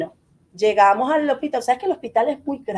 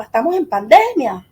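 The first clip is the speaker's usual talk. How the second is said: mock yell of a frantic husband; retold yelling; 5 semitones higher, 4 dB louder than usual. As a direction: imitate his protest, pushing each phrase out. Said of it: "Es lo que gritaba".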